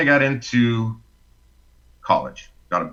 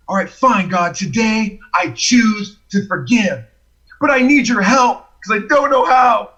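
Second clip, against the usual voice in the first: high, strained plea to God; exasperated prayer; 9 semitones higher than usual. Retold or imitated. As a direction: plead upward, giving in under protest. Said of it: "I'm praying to God".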